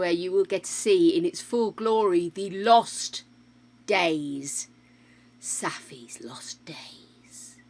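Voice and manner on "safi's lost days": slow, hushed movie-trailer whisper; dramatic whisper. Pretend. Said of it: "Sounds ever so dramatic".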